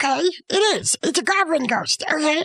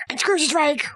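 ordinary delivery

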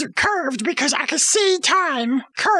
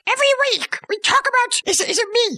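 higher, fast, flustered protest; defensive outburst; 7 semitones higher, faster than usual. Real, not acted. Storyteller snaps back at cohost's question, high and fast. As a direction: brush off the question in a rush.

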